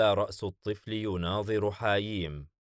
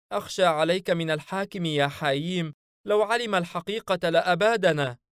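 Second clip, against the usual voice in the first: high, anxious companion voice pleading with his master; fretful creature, much higher than the narrator; a nervous spirit begging for reassurance, voiced high; worried jinn sidekick; 10 semitones higher, 4 dB louder than usual.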